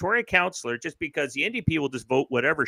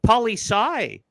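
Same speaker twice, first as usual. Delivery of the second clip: mocking student; 7 semitones higher than usual.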